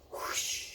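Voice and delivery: whispering a whoosh sound effect; whispered whoosh. Shown as a demonstration. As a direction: breathe out a soft whoosh. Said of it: "It also says".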